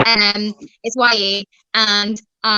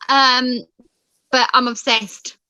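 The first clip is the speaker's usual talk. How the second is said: ordinary talk, nothing marked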